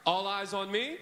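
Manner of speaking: loud, rising call for attention. Shown as a demonstration. Teacher practising the call with the kids. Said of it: "so let's practice it".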